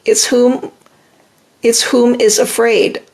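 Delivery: ordinary talk, nothing marked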